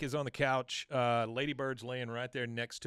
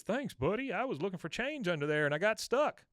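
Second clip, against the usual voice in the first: high-pitched goofy thanks; Bill's voice; 7 semitones higher than usual. Retold or imitated. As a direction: pitch it way up like a sheepish, silly neighbour. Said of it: "he says".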